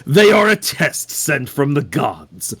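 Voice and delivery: measured, solemn cult devotee voice; calm solemn devotee. Acted. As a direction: speak like a serene zealot. Player voices Witness character.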